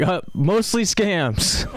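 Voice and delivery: slow, dry deadpan answer; a slow sheepish admission. Retold or imitated. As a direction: drag it out flatly as the punchline. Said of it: "I'm like".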